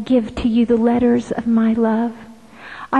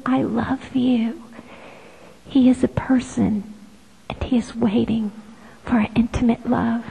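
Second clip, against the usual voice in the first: soft, unvoiced reverent whisper; hushed, intimate devotion; prayerful whisper; 5 dB quieter than usual.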